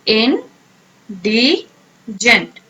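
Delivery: in, di, jent, split apart with pauses; slow, syllable by syllable. Shown as a demonstration. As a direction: break "In डी एंड जेंट" into slow separate syllables. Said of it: "pronounce करें आप".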